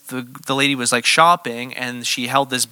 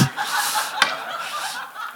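breathy excited laugh; 10 dB quieter than usual. Real, not acted. Thrilled at the healing chance.

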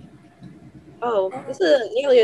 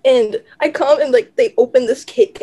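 ordinary talk

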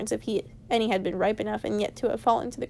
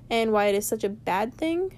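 usual speaking voice

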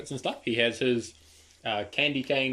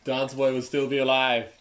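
grand, louder storyteller lament; mock-dramatic narrator; 4 semitones higher, 5 dB louder than usual. A narrator's reading. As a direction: ham up the tragic narration.

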